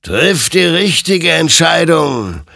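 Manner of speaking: high, loud, drawn-out spectral warning; slow otherworldly command; stern admonition. Acted. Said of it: "ermahnte ihn sein Gegenüber".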